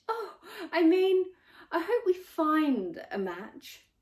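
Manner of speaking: flustered correction. A narrator's reading. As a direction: hurry to cover the slip. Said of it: "she corrected herself".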